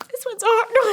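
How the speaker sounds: words cracking with grief over a sick dog; choking up. Real, not acted. Owner of a sick old dog, voice breaking. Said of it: "This is gonna be a hard one on us".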